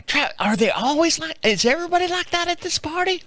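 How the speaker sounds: mock Tennessee lady asking in disbelief; Tennessee twang. Neighbor's wife asking about the party guests, incredulous twang. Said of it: "she's got a little twang to her".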